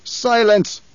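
a furious high roar. A narrator's reading. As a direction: cut him off with a bellow. Emperor cuts off the Baron again.

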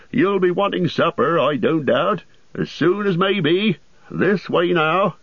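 high, chatty landlord voice; an overworked host rattling on; bustling innkeeper. A narrator's reading. Landlord explains rooms.

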